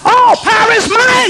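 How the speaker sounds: high shouted scripture, slowed on power; preaching at full force. Acted. Preacher crying scripture to the congregation, full shout. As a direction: thunder the promise out.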